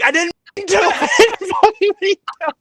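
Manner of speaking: slow high whimpering apology; pleading through tears, retold; mock crying. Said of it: "and I'm just crying".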